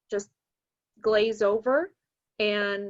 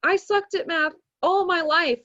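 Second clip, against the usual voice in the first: higher, louder emphatic admission; retold frank confession, voice raised; 8 semitones higher, 7 dB louder than usual. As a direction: own up to it emphatically. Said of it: "And I was like".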